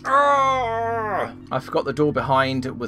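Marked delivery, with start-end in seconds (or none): 0.0-1.3 high, drawn-out "ah" of exasperation; exasperated high groan; frustrated cry. Real, not acted. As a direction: let out a long frustrated yell. Frustrated cry after own blunder.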